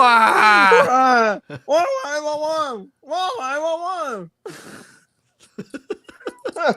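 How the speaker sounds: high, loud muted-trumpet wah wah; Charlie Brown adult wah. Pretend. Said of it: "Charlie Brown adult falling down a flight of stairs".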